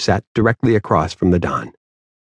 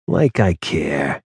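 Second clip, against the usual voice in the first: slow and lower, couldn't care less; dismissive; 4 semitones lower, much slower than usual. Acted. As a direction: shrug it off coldly. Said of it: "offering a smile that appeared painful".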